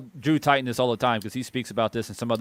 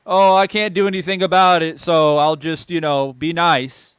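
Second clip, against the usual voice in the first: mock excuse; 4 semitones higher, 6 dB louder than usual. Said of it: "This is where it's like".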